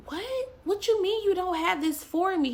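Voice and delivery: high, loud incredulous cry; shocked disbelief. Retold or imitated. Arguing back at God, shocked and incredulous. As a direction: fire the question back in disbelief.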